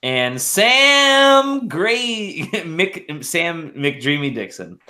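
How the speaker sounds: high, very slow drawn-out name call; announcer intro. Pretend. Host introducing a player, stretched high announcer call.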